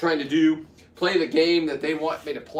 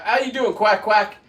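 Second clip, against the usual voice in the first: higher, louder sudden hello; cheery greeting; 9 semitones higher, 7 dB louder than usual. Real, not acted. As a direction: break off the rant to greet them brightly. Suddenly greets someone mid-rant.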